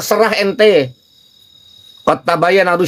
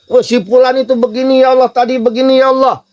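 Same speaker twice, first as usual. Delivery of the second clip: complaining prayer; 7 semitones higher than usual. Shown as a demonstration. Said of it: "Enak gak gitu?".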